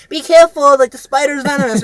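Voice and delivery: high, loud little-kid imitation; hammed-up child warning a passerby, high and loud; mocking kid voice. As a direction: squeak it like a smug little kid. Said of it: "the kid was there and he was like".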